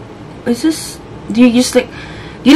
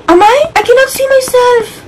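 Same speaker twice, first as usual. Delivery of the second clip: high-pitched, bewildered wail; panicked; 12 semitones higher than usual. Real, not acted. Player unable to see herself in game, panicked.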